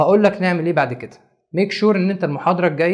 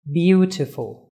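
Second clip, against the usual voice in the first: "beautiful" drawn out very slowly, first syllable BEAU stressed; much slower than usual. Shown as a demonstration. Teacher to class, slow stressed demo of "beautiful". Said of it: "اللي في الأول".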